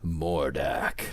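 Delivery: low, dark "Mordak", drawn out; a hint of menace on "Mordak"; menacing villain voice. Shown as a demonstration. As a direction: growl "Mordak" like an evil name. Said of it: "you can say it in a ma".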